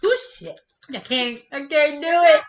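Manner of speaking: sudden yelp at sour taste; shocked outburst. Real, not acted. Reacting to very sour candy.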